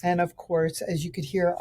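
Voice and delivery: ordinary delivery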